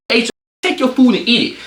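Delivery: high-pitched, irritated command; retold angry order. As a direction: snap back in a retold quarrel. Retold quarrel with dad.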